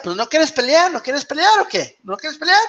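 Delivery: high-pitched, insistent Spanish goading; mock challenge. Replaying one fighter goading another to fight, high insistent mock challenge. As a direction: badger the rival with repeated high questions.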